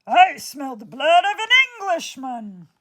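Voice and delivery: the giant closes in; giant sniffing. Acted. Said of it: "roared the giant".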